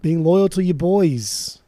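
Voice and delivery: put-on tough Boston guy, in a playful Boston accent. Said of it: "All right, Mister Boston over here".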